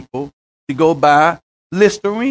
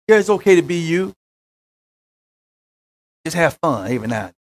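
soothing false prophet; 4 dB quieter, faster than usual. Retold or imitated. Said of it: "would rather hear that voice".

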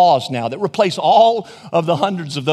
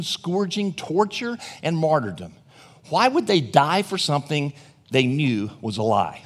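usual speaking voice

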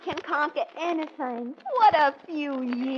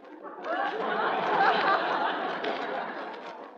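coy breathy giggle; 9 semitones higher than usual. Acted. Leela laughs after confessing age.

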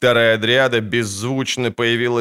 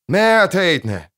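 loud, slow shout into the forest; 8 semitones higher, 4 dB louder, slower than usual. A narrator's reading. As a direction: call out loudly and slowly. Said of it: "крикнул он снова".

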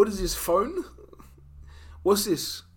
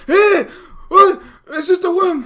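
much higher and louder mock mum voice; a hammed-up reassuring mother; 13 semitones higher, 10 dB louder, slower than usual. Retelling mum brushing off the worm, in a high mock voice. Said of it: "she's like".

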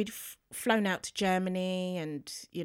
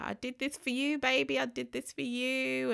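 tender to daughter; 6 semitones higher than usual. Retold or imitated. Runner phoning her daughter after the race, high and tender. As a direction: say it up high and tender to your child. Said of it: "was just like".

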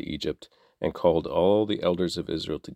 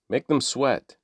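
higher, pressing command; harsh order; 6 semitones higher than usual. Acted. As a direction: push the cruelty into the words. Pharaoh ordering harsh labor.

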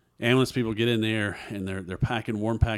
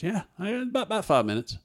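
much higher, matter-of-fact doctor voice; casual doctor; 12 semitones higher, 3 dB louder, faster than usual. Retold or imitated. Doctor telling the patient how close he came, in a high offhand voice. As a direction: drop the bad news lightly. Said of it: "He goes".

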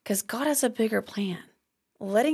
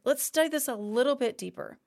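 normal talking voice

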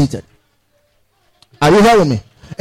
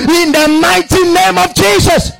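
high ringing cry of "Jesus"; preached shout; 9 semitones higher, slower than usual. Acted. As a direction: lift the name of Jesus high. Preaching climax.

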